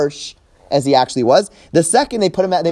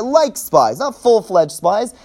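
Like raised and leaned on, then not full-fledged spies slowed down; stress on like; 4 semitones higher, slower than usual. Shown as a demonstration. Teacher pressing on Like for the class. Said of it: "They're trying to belittle it".

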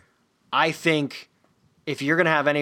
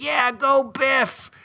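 high, drawn-out mock cheer; fan cheering; 11 semitones higher, much slower than usual. Fan cheering a tackle from the couch, high stretched cheer. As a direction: cheer him on from the stands. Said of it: "I'd be like".

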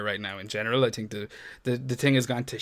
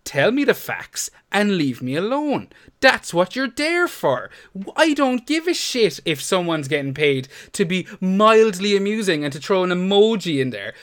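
angry rant; 6 semitones higher than usual. Real, not acted. Podcast host ranting about the FPL Twitter account, heated and building. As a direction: vent at the Twitter account, voice climbing. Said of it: "I love your enthusiasm".

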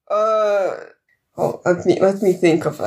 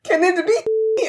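put-on pleading child; 9 semitones higher than usual. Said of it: "and then being like".

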